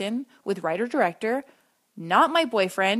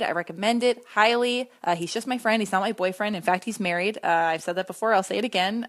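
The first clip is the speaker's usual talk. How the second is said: ordinary talk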